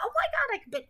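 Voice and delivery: thrilled, high-pitched exclamation; excited. Real, not acted. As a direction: squeal it high with delight. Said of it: "I just got excited".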